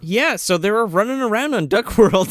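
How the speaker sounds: ordinary talk, nothing marked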